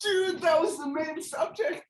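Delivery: very high, drawn-out wail; dismayed outcry. Real, not acted. Co-host already read the main subject, dismayed wail. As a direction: wail it in comic dismay, slowly.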